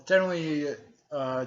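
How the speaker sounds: ordinary talk, nothing marked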